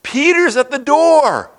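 high, loud, slow overjoyed shout; excited exclaim. Acted. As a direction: burst out with joy at the news. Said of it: "she ran back ... and exclaimed".

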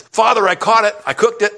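high, excited son reporting to father; eager announcement. Acted. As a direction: burst in with proud news, pitch up. Son returns eagerly with food.

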